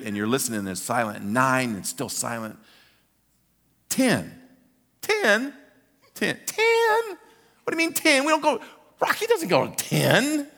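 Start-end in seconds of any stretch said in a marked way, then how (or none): 3.9-4.4 high, falling single number; startled count. Retold or imitated. Stunned at the count. 5.0-10.5 very high, squeaky disbelief; incredulous protest. Retold or imitated. Fan cannot believe the count.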